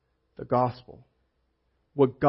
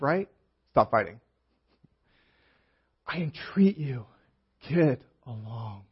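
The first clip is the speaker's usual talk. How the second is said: paraphrasing Paul; 2 semitones higher, slower than usual. Retold or imitated. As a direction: coax the church like Paul, then soften. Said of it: "Paul says".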